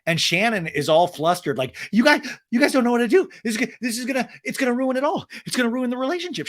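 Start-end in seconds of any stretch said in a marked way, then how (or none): 1.9-6.5 high, fast, loud panic; mock panicked gabble, fast and high; panicking. Shannon panicking to the women at lunch, high fast mock panic. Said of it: "She's panicking".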